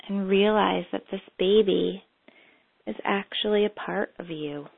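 calm, unhurried meditation guide; guided meditation. Acted. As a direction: lead them gently into memory.

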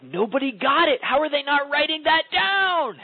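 high, slow mock dismay; dismayed inner voice. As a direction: squeak out a hurt, puzzled inner thought. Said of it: "you're like".

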